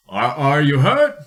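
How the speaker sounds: lightly voiced worried explorer; a hint of concern.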